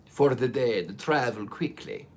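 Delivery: low, measured vampire voice; Dracula. Acted. Host quoting for Romania, low Dracula voice. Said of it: "Little Dracula".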